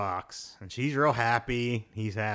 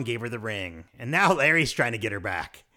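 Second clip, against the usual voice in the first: ordinary talk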